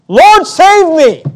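high, drawn-out plea to be saved; crying out for help. Retold or imitated. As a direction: call out slowly, pleading for rescue. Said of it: "and he cried".

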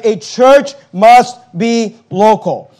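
"must be local" slow, word by word; slow emphasis. Real, not acted. Preacher stressing that the word local is important, slow and emphatic. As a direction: land firmly on "must" and "local". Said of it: "that word local is important".

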